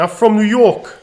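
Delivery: high, loud demo New York accent. Shown as a demonstration. Coach showing how a New Yorker says it, New York accent. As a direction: show off a punchy New York "York". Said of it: "try saying".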